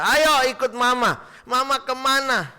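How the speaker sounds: put-on high, loud little child calling mama; a three-year-old asking his mom where they're going, high and eager; child's voice. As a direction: play a small child tugging at mom. Child voiced in sermon story.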